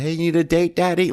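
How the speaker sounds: sultry come-on to a passing car; imitating a prostitute. Prostitute calls out to a passing car, high coaxing. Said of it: "you hear one of the prostitutes".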